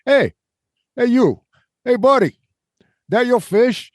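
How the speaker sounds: high-pitched mock New Yorker calling out; butchered New York accent. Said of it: "I am butchering the New York accent".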